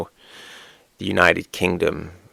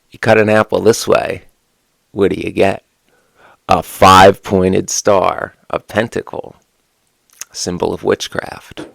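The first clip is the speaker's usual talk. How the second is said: ordinary talk, nothing marked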